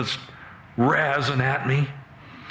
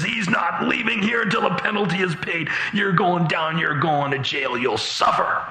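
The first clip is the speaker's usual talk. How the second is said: high, jeering tirade; gloating bailiff; 6 semitones higher than usual. Acted. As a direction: taunt the condemned man, piling up threats. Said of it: "He starts laughing out loud and he says".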